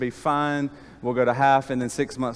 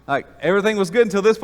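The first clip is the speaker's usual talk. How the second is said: fast, high, louder burst of disbelief; startled and rushed; 6 semitones higher, 5 dB louder, much faster than usual. Retold or imitated. Telling the congregation about the doctor's answer, fast and startled. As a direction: blurt it out fast, still shocked.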